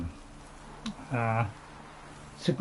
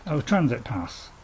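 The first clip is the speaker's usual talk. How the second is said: usual speaking voice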